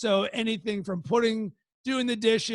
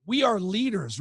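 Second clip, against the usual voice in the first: usual speaking voice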